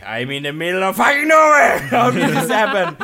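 hammed-up yell at being stranded; stranded panic. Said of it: "I was like".

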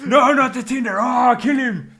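high, loud hammed-up wail; dying man begging over his Tinder photo; mock despairing shout. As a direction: cry out in comic despair. Voicing the dying Tinder victim.

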